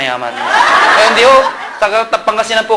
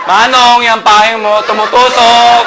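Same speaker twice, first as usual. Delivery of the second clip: louder, drawn out angry protest; mock angry commuter complaining louder; angry complaint; 4 dB louder, slower than usual. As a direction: scold the man, getting louder. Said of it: "Galit na galit".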